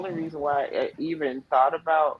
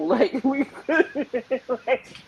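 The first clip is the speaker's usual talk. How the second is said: giggling through higher words; laughing; 10 semitones higher than usual. Retold or imitated. Husband and wife puzzling over the timing, laughing through it. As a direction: laugh while asking. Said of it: "we were both laughing".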